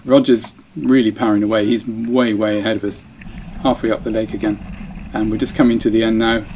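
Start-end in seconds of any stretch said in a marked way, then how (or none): none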